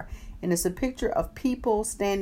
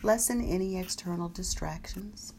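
guided meditation; 7 dB quieter than usual. Acted. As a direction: guide them gently through the body. Guided body scan meditation.

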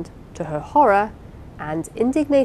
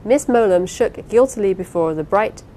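usual speaking voice

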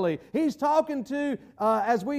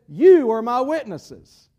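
YOU punched loud and drawn out; slow commission with a loud, long "You"; emphatic charge; 8 dB louder, slower than usual. Acted. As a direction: hit "You" hard, then let the rest fall. Acted command of Jesus to disciples.

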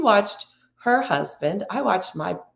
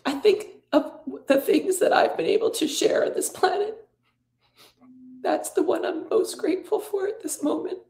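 real emotion, voice pitched much higher; choked up; 12 semitones higher than usual. Real, not acted. Speaker grateful for late brother, choked up with emotion. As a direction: fight back tears while giving thanks.

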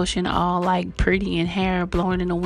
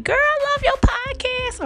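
very high-pitched squealed fan voice; gushing fan. Acted. Fan stopping the host on vacation, gushing in high voice. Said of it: "people stopping me and saying".

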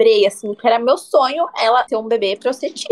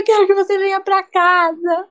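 retold crying; 9 semitones higher than usual. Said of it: "Eu liguei pra ela chorando".

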